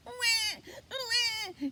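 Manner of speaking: high mock crying wail; baby crying. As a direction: cry out like a newborn.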